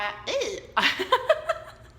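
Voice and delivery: a short, high, sudden laugh at herself; embarrassed laugh. Real, not acted. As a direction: laugh off the slip. Laughs at her own slip.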